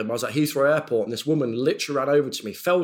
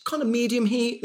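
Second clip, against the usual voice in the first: kind staff voice; 8 semitones higher than usual. Retold or imitated. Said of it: "They'll sort of go".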